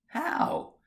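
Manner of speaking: sudden high startled question; startled How. Acted. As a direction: jump at it in surprise.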